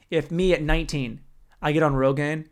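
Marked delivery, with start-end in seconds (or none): none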